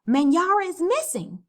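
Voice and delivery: high, loud cry of alarm; alarmed shout. Acted. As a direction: call out the news in a panic. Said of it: "loud shouts and commotion".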